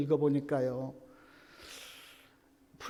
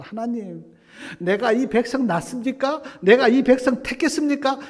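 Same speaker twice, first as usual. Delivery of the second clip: loud, much higher exasperated protest; talking back to God; 9 semitones higher, 8 dB louder than usual. Retold or imitated. Moses confronting God over the people, loud exasperated protest. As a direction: fling the questions back at God. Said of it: "하나님 앞에 막 대들더라고".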